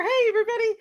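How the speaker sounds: usual speaking voice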